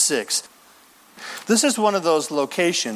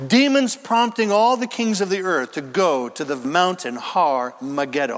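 usual speaking voice

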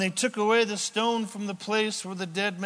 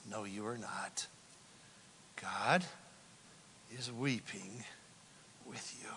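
tender reassurance; 9 semitones lower, 16 dB quieter than usual. Real, not acted.

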